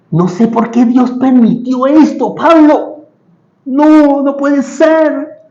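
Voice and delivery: high, drawn-out mock wail of protest; complaining lament. Acting out Silas complaining to Paul in prison, high mock lament. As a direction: plead in dismay, stretching out the last words. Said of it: "No se estaban quejando".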